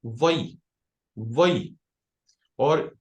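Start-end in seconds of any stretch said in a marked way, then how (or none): 0.0-1.8 वई said as an isolated syllable closing on a y glide; teacher's clear demo of वई, ending on the y; doubled yod. Shown as a demonstration. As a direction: model वई separately, pause, then repeat it. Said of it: "योद को दो दफा पढ़ना है".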